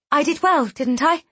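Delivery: put-on high, eager child voice; a small girl, proud and hopeful; little girl voice. A narrator's reading. Young Riel, still coughing, to Tal.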